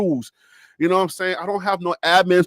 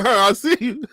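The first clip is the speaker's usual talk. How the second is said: ordinary talk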